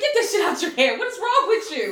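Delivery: high, loud telling-off; exasperated scolding. Retold or imitated. Boyfriend tells her off about the liquor, exasperated scolding.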